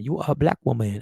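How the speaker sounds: put-on low-pitched, muddled French-Chinese accent; botched French-Chinese accent. Replaying the shopkeeper's line, botched French-Chinese accent. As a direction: badly fake a French accent drifting into Chinese. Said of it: "French woman and a Chinese woman".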